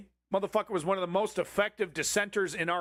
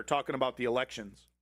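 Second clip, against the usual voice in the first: ordinary delivery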